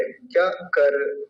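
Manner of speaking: कर said slowly as the common mistake; wrong way demo. Shown as a demonstration. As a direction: show the wrong कर, drawn out. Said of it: "कर बोलते हैं". (गलत उच्चारण, धीरे से)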